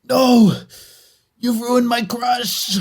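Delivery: high, drawn-out melodramatic wail; hammed-up cry of ruin; mock despair. Venom's host lamenting a ruined crush, mock despair.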